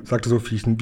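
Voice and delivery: plain reading voice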